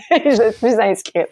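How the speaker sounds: slow laughing delivery of the punchline. Retold or imitated. Laughing as she tells the host she signed up. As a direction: laugh through the words, drawn out. Laughing at her own haste.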